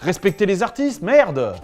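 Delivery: loud indignant rebuke, swearing at the end; exasperated outburst. Acted. Teacher defending French dubbing artists, loud exasperated outburst.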